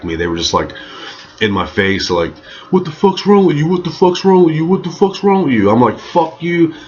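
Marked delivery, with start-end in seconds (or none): 2.7-5.7 high, insistent, repeated challenge; mock outraged yell. Two dudes in the storyteller's face, mock outrage. 6.0-6.7 high-pitched defiant comeback; angry retort. Retold or imitated. Storyteller answering the two dudes, acted angry defiance.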